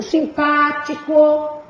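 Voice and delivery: "simpático" slowly, PÁ drawn out; stress on PÁ. Shown as a demonstration. Teacher showing the tonic syllable, long PÁ in "simpático". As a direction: stretch the second syllable of "simpático". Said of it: "a sílaba tônica é o pá".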